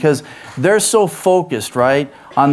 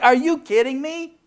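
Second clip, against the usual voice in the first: mock exasperated Jesus, higher; incredulous Jesus, pitched up; 11 semitones higher than usual. Jesus scolding his disciples, mock exasperated. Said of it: "Jesus is like".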